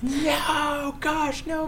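high, wailing mock sobs; mock hysterical weeping. Boys told their dog is gone, mock hysterical wail. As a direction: wail like a heartbroken kid, hammed up. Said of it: "weeping in hysterics".

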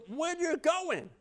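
high, drawn-out mock dismay; stretched, high-pitched comic protest; alarmed disbelief. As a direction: retell a baffled plea to a deserting helper. Choking man questions departing friend.

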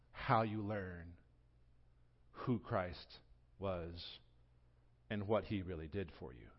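softly preaching the final appeal; hushed sermon close. Acted. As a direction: drop to a near hush to land the point.

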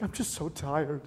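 soft, slow imitation of tired crying; tearful exhaustion. Husband imitating his tired, crying wife, tearful and soft. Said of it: "she's, like, crying".

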